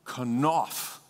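kanaf said slowly, ka-NAF; kanaf, stress on the second syllable; Hebrew word kanaf. Shown as a demonstration. Pastor teaching the congregation a Hebrew word, careful pronunciation. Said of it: "the Hebrew word for wings in this verse is the word".

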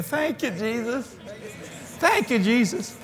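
high, soft cry of thanks; retold thankful praise. Pastor and guest saying thanks together, high retold praise.

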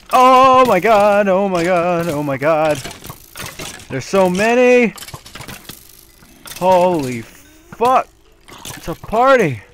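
shrieking in high panic; losing it at the swarm; scared horror game streamer. Real, not acted. Boss fight horde appears.

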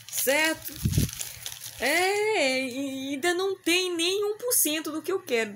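high and loud, thrilled; excited exclaim. Real, not acted. Exclaiming about needing more.

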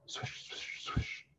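soft, voiceless whisper; hushed sound-effect whisper; whispered swishing. Acted. Imitates the horse's tail swishing.

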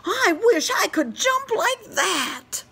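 very high, tiny tadpole voice. Acted. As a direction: squeak it like a baby tadpole. Tadpole wishes.

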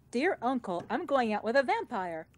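ordinary talk

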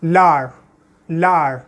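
लार as French l'art, lar with silent t; French pronunciation. Shown as a demonstration. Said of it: "I would pronounce like this".

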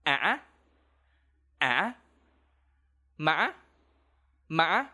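á and má with a broken, catching rise; high broken tone. Shown as a demonstration. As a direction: break the voice mid-tone. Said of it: "This tone gonna sound like this".

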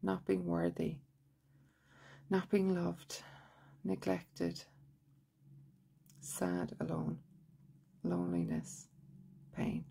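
measured monotone affirmations with long pauses; guided tapping recitation. Shown as a demonstration. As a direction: recite each phrase evenly and quietly, leaving room to repeat.

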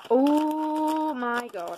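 high, long drawn-out oh; delighted gasp of recognition. Real, not acted. Friend unwrapping a long-wanted palette, delighted. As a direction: stretch it out in rising delight.

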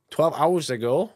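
ordinary delivery

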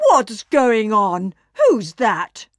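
drawn-out startled question; mildly alarmed cow, just woken; startled awake. A narrator's reading. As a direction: ask as if jolted from sleep. She woke up with a start.

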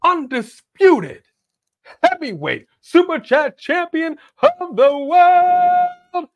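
booming, stretched fight-night introduction, pitched high; hammed-up wrestling announcer building to a long high peak; ring announcer. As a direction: announce a champion, dragging out each title.

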